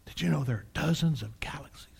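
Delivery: hushed, quick whisper; awed whisper. Acted. Preacher recalls old news of dozens of galaxies, whispering. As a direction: whisper it like a marvelous secret. Mimics awed secret of past knowledge.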